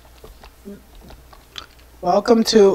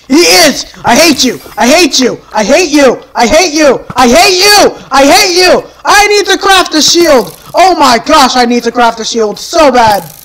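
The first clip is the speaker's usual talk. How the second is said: frantic anger; 3 semitones higher, 3 dB louder than usual. Real, not acted. Player finding the monster waiting, frantic angry yelling. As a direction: yell in mounting exasperation.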